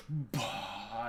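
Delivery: low, soft dismissive scoff; mock hipster scoff. Hipster vapers scoffing at the narrator. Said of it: "everybody's like".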